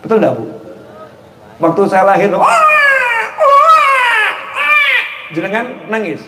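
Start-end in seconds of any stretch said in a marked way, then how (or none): 2.3-4.3 high wailing newborn cry; baby crying. Retold or imitated. Preacher imitating himself as a newborn crying at his birth, high wail. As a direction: wail like a just-born baby.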